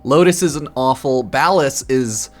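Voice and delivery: ordinary talk, nothing marked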